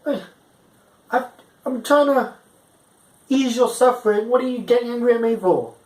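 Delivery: high, incredulous protest; high-pitched mock indignation; indignant. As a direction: sound wronged and baffled. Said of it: "I'm like".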